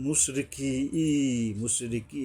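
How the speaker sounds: মুশরিকি with a held final ee; one-alif elongation. Shown as a demonstration. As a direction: draw out the end of মুশরিকি. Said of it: "এক আলিফ টানতে হবে". (মুশরিকি এক আলিফ টেনে)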